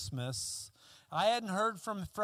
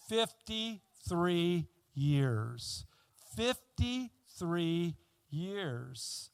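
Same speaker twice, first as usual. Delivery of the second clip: slow emphasis; much slower than usual. Acted. Emphasising long isolation.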